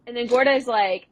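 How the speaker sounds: ordinary talk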